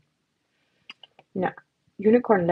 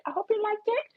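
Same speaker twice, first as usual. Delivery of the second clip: higher, softer sing-song; soft sing-song version; high cutesy repeat; 12 semitones higher, 5 dB quieter than usual. Pretend. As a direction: say it again sweet and high.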